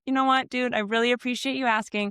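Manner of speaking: warm praise. Retold or imitated. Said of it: "I was like".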